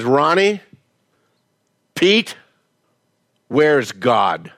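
a hint of a stern pastor; stern pastor question. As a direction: ask it slowly like a stern pastor. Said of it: "Pastor Jackson looks at these two boys".

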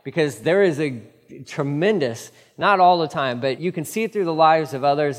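ordinary talk, nothing marked

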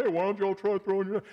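imitating a man hollering from shore; mock calling out. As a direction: call to the boat, half-shouted. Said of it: "he's out there shouting".

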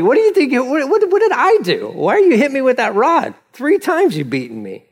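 high, aggrieved run of questions; protesting donkey. Acted. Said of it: "it's asking questions".

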